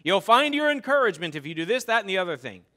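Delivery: loud, offhand pointing away; dismissive shout. Retold or imitated. Preacher mocking someone who just points the way, loud. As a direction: brush them off with a loud shout. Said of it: "and saying".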